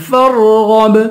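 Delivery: فَارْغَب with full ر and bounced ب; qalqalah on ba. Shown as a demonstration. Teacher showing qalqalah, فَارْغَب with ب bounced. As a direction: thicken رْغَ in فَارْغَب, echo the ب. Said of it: "با پہ قلقلہ کریں گے".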